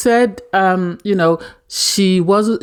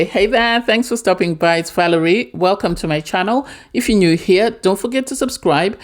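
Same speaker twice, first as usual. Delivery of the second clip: upbeat channel-welcome host voice, a bit higher; bright welcome-to-my-channel read; youtuber intro; 4 semitones higher than usual. Acted. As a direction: greet viewers like a YouTube host.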